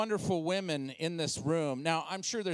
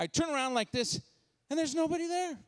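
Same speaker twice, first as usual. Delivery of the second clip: ordinary talk, nothing marked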